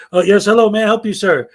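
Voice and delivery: loud, eager "may I help you"; cheerful clerk greeting. Acted. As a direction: greet the customer like a chipper clerk.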